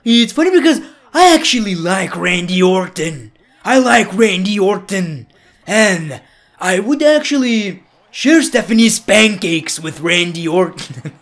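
loud, hammed-up wrestler voice; Triple H impression. Wrestler admits liking his rival, hammed-up impression. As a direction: declare it pompously in a put-on voice. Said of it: "I can just do a Triple".

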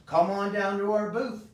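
high and loud, a booming barker's invitation; excited call-out. Real, not acted. Host calling new viewers to the convention booth, excited and loud.

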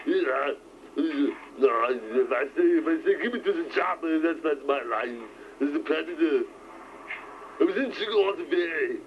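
lightly put-on Austrian strongman; Arnold Schwarzenegger. Trying a double impression for a friend, rough strongman voice. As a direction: do a rough Austrian action star. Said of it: "Schwarzenegger doing an impression of Stallone".

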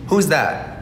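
ordinary delivery

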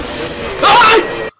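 sudden high shriek of fright; startled scream. Real, not acted. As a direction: jump out of your skin. Susto repentino en el juego. (grito de susto agudo)

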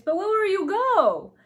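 high, loud, drawn-out plea; distressed question. A narrator's reading. Ellie asks the departing king where they'll go, distressed. Ellie upset about them leaving.

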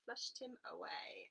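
usual speaking voice

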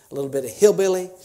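playful hillbilly accent sample. Shown as a demonstration. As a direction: toss off a bit of hillbilly accent. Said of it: "I can speak a".

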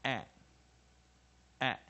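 "a" as a short, isolated eh sound; crisp separate "a", the short vowel in act. Shown as a demonstration. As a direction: model "a" as the short E, twice with a gap. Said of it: "一的短母音就念".

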